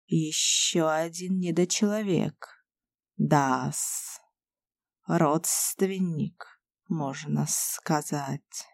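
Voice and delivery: low, soft, slow serpent voice; a sly snake sneering; hissing whistling voice. A narrator's reading. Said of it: "Раздался шипяще-свистящий голос".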